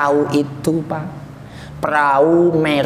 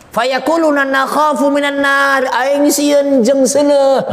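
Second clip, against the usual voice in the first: high, mock-tearful cry; acted fearful wail; 7 semitones higher than usual. Souls tell of fearing the fire, acted wailing. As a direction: draw it out like a scared weeper. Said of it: "gitu ya".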